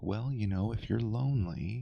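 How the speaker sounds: high, soft put-on counselling voice; mock medium advice. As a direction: imitate a medium gently offering trite advice.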